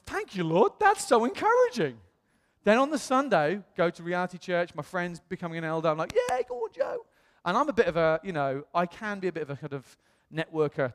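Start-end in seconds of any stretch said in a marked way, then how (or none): 0.1-2.0 retold high-pitched gush of thanks; encouraged gushing. Pastor retelling his thanks to the Lord, high, gushing. 6.2-7.1 falsetto cheer for a friend; mock cheering. Friend becoming an elder, cheered in mock falsetto.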